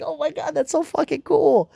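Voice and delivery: high, gushing fanboy awe; excited gush. Retold or imitated. Friends geeking out over Superman's speed, high excited gush. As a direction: squeal it like two fans geeking out.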